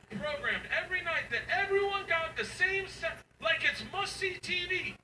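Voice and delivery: high, drawn-out comic disbelief; incredulous rant. Acted. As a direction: pitch it up in baffled amazement. Comic disbelief at daughter's belief.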